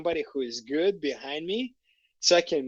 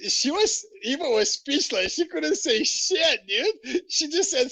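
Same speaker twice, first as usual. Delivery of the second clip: laughing through it; 10 semitones higher than usual. Real, not acted. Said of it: "laughing while telling".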